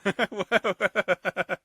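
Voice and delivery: high real laugh. Real, not acted. Host laughing at the Glasgow funeral saying, high. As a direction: let the laughter spill out.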